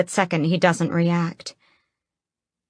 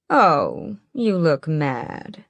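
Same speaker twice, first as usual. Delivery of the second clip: sinister drawl; much slower than usual. A narrator's reading. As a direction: stretch it out, amused and menacing. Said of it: "His drawl makes everything he says carry a sinister edge".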